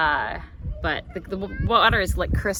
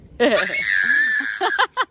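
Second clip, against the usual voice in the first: loud burst of laughter; real belly laugh after a funny story; big laugh; 6 semitones higher, 8 dB louder than usual. Real, not acted. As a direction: crack up loudly. Laughing at her own story.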